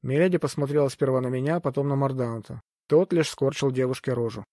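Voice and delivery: plain reading voice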